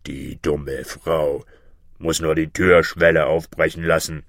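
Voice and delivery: low monster bird voice; deep, boastful creature; know-all griffin. Acted. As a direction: pronounce it from down deep, with scorn. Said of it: "sagte der Vogel Greif".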